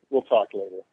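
ordinary talk

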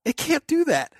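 high, indignant disbelief; incredulous protest. Retold or imitated. Told the drone flew home, high incredulous protest. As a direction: refuse to believe it. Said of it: "And I'm like".

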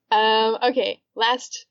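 ordinary talk

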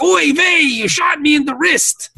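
high Yiddish whine, laughing through it; yiddish accent. Acted.

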